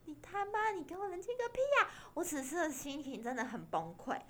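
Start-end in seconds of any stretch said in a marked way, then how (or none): 0.1-1.9 mock angry retort. Snapping at calm boyfriend.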